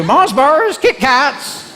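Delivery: high, loud vendor holler; trolley seller's cry. Acted. Trolley woman calls to surgical ward patients, high ringing shout. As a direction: call out the wares to the whole ward. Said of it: "she'd go".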